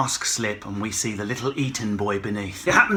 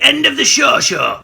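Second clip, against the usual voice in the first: higher, loud jingle shout; 10 semitones higher, 8 dB louder than usual. Pretend. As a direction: call out the jingle.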